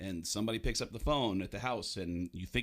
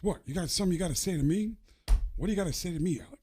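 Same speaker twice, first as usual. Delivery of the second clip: higher, fast challenging Pacino bark; Al Pacino impression; 7 semitones higher, 3 dB louder, faster than usual. Pacino confronting Baldwin, in a Pacino impression. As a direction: confront him Pacino-style. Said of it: "He says".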